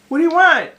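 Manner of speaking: loud, high-pitched demand; calling out. Real, not acted. Owner answering the cat, loud high-pitched call.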